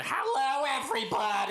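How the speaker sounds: high-pitched caricature of a brash caller; Fran. Acted. Special caller greeting the hundredth episode, hammed-up Fran voice. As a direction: burst in with a shrill, showy greeting. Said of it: "we have a special caller".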